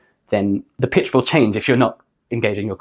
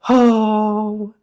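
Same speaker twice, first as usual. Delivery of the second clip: sighed note; 10 semitones higher than usual. Shown as a demonstration. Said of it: "sigh into the note".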